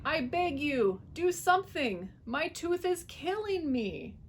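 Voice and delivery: high, slow pleading; begging. A narrator's reading. Fox on his knees begging the dentist, pained. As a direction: whine through the pain.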